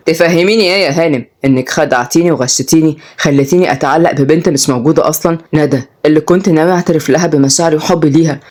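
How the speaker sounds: low, accusing male character voice; Odai, betrayed. Acted. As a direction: reproach her with restrained hurt.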